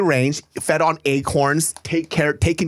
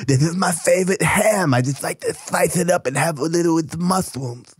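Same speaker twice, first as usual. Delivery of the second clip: Mike Tyson; 3 semitones higher than usual. Acted. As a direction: lisp it like the famous boxer. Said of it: "It's me, Mike Tyson".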